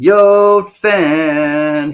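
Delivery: a loud, bright "Yo" hollered out; loud call-out. Real, not acted. Streamer hailing a friendly viewer, loud shout. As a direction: call out to him across the room.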